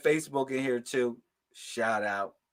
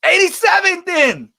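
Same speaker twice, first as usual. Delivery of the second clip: high loud mock number call; mocking viewers; 12 semitones higher, 10 dB louder than usual. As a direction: shout the number like a pushy viewer. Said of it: "they like".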